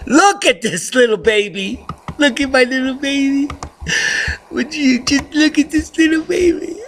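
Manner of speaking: squeaky pet-talk voice; baby talk. Acted. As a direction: coo at the kitten like a baby. Cooing over the rescued kitten.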